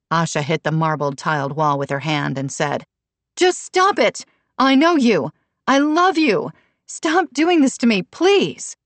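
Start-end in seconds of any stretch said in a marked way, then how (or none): 3.3-8.8 high-pitched, exasperated plea; desperate outburst. A narrator's reading. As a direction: burst out, begging her to stop. Mother hits wall, begs daughter.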